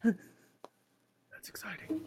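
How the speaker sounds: soft unvoiced aside; whisper. Real, not acted. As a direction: breathe it out giddily. GM whispers after laughing.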